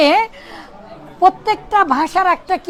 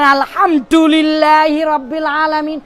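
put-on Arabic read with an American accent. Teacher imitating Americans reading Arabic, American accent. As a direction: recite it like an American learner. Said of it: "American মানুষেরা আবার আরবি কিভাবে পড়ে".

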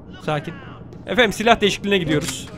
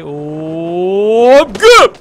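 mock crash landing; 6 dB louder than usual. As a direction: swoop up and land on a booming güm. Imitating his landing with a noise. (yükselen hop, yüksek sesle güm)

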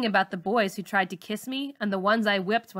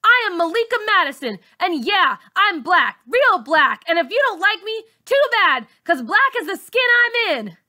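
very high, loud defiant yell; 10 semitones higher, 8 dB louder than usual. Acted. Girl finally yelling back at her bully, high and loud. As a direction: stand up and scream your pride. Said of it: "I yell".

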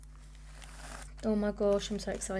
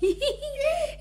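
high, laughing squeal of excitement; excited squeal; 5 dB louder, faster than usual. Real, not acted. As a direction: squeal it out, giddy. Unboxing the silicone baby.

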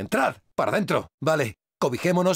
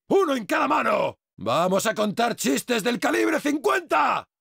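high, gloating war cry; boastful battle shout; 5 semitones higher than usual. Acted. Battle boast with big guns.

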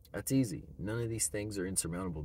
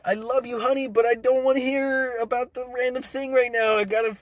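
husband brushing off partner; 14 semitones higher, 9 dB louder than usual. Pretend.

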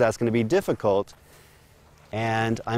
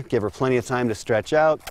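ordinary talk, nothing marked

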